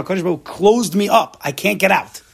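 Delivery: ordinary talk, nothing marked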